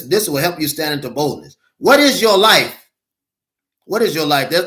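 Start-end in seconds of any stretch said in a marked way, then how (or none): 1.8-2.9 higher, louder preaching cry; emphatic shout. Acted. Preacher challenging the congregation, loud and high. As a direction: throw the question out at the congregation.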